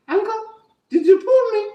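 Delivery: high little rabbit asking innocently; high clever rabbit voice; rabbit's voice. Acted.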